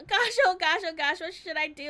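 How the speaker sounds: loud, high flustered gush; flustered panic. Acted. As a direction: babble in a blushing panic. Tsukasa flustered by proposal.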